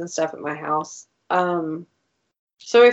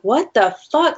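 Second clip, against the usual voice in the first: higher, louder shocked "what the fuck"; startled outburst; 6 semitones higher, 5 dB louder than usual. Retold or imitated. Interrupted while cooking, blurting at the phone, higher and louder. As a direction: blurt it out, caught off guard. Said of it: "And I'm like".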